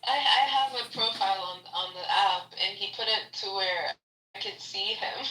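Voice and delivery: ordinary talk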